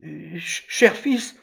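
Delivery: faltering start, jumping high; stammer, then plead in a high voice; flustered, high-pitched stutter; panicked mother. A narrator's reading. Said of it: "dit la mère affolée".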